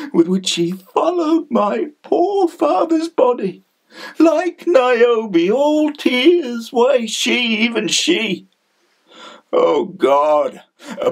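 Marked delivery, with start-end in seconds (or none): 0.1-8.5 high, grief-stricken rush of feeling; anguished outpouring. Acted. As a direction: let bitter grief lift the voice high. Anguished memory of mother's mourning.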